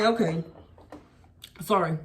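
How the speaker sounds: dry, insincere okay ... sorry with a pause; flat grudging sorry, low and dry; flat fake sorry. Shown as a demonstration. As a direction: show the sarcastic apology. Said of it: "This literally is how I tell him sorry".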